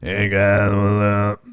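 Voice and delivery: low, throat-straining impression; strained drone held down low in the throat; [name]. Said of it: "[name], how do you do that".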